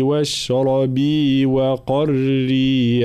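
slow chanted recitation; Quran recitation. Shown as a demonstration. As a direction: intone it slowly for students to repeat. Teacher recites for repetition.

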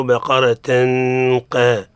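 "بقرة قا" with a held nasal hum before قا; mistaken tanween. Shown as a demonstration. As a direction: imitate the faulty join of "بقرة" into "قا". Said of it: "مثل بعض المغارب يقولوا".

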